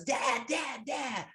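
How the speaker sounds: high, light mock holler of a little girl; a daughter calling her dad to dance, a high mock holler; imitating a holler. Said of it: "And she hollers".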